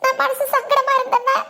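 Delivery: tearful complaint to a friend; weepy, catching sobs at phrase ends; crying. Acted. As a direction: pour it out between sobs. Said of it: "அழுகாத".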